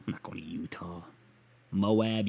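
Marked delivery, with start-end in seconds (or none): 0.1-1.0 softer whispered repeat; breathy whispered refusal. Shown as a demonstration. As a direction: whisper it to yourself.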